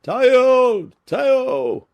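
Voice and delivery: very high, very loud halloo; hunting shout. A narrator's reading. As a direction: call the hounds across the field.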